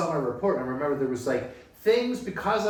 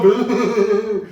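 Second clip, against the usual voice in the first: a louder put-on kid's boo; 4 semitones higher, 8 dB louder than usual. One kid in the focus group, mock booing.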